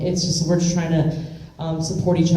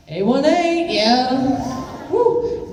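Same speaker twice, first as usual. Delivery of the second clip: excited hometown cheer; 8 semitones higher, much slower than usual. Real, not acted. Proud of her hometown Valley.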